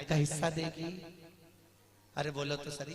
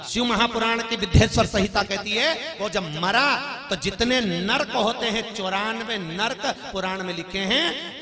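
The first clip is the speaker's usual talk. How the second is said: loud, higher sermon delivery; fiery preaching; 7 semitones higher, 12 dB louder than usual. Acted. Storyteller preaching to the Ujjain women, loud and high. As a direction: proclaim it to the whole crowd.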